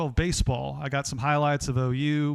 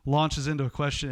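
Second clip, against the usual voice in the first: ordinary talk, nothing marked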